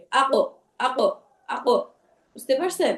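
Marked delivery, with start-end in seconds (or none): 0.1-1.8 slow "আ কফ", the ক doubled each time; qalqalah echo. Shown as a demonstration. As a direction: bounce off the ক in each "আ কফ", unhurried. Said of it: "সেটা দুইবার আসবে". ("আ কফ"-এ ক দুইবার, ধীরে প্রতিধ্বনি দিয়ে)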